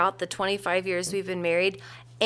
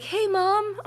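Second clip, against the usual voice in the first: higher teasing call, breaking into laughter; teasing her friend as mom, laughing; 12 semitones higher than usual. Retold or imitated. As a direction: giggle out a mock greeting. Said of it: "I'll be like".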